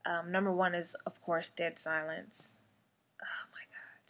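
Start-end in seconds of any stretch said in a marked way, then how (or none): 3.2-4.1 breathless whispered oh my God; scared whisper. Real, not acted. Host spooked talking about Dead Silence, whispering. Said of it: "really getting me scared right now".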